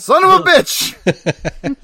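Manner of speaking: loud, slow, drawn-out yell; loud shout. Pretend. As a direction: bellow it out. Shouting Doug Ray retort.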